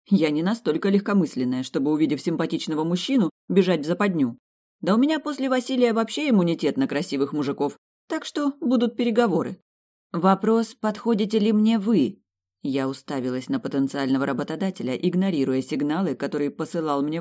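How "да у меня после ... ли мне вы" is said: higher pitched, sassy challenge; defiant. Acted. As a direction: turn the question back on him. Heroine's defiant retort.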